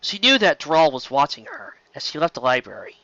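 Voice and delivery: usual speaking voice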